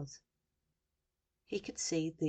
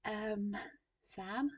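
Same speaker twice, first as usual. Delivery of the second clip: halting, higher-pitched uncertain answer; falter, then answer as if unsure; stuttering; 4 semitones higher than usual. A narrator's reading. Sam to the journalist on air, stuttering. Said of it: "he stuttered".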